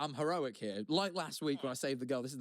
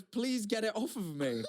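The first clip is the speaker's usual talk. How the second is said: higher, slow mock-desperate begging; imitated plea; 6 semitones higher than usual. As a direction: act out a crushed man pleading. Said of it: "And he goes".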